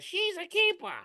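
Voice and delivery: very high, squeaky cartoon squirrel punchline. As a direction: pipe it up like a tiny squirrel. Squirrel in the meme speaks.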